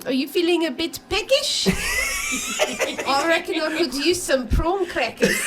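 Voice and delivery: put-on shouted alien Prawn accent. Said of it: "get into the accent".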